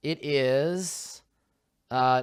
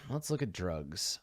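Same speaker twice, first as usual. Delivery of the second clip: much softer under-the-breath repeat; muttering; 6 semitones lower, 10 dB quieter than usual. Real, not acted. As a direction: drop it to a mumble. Repeats the line, second softer.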